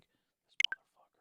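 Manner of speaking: whispered jealous curse; whispered muttered insult. Retold or imitated. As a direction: hiss it under your breath. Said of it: "I'm like".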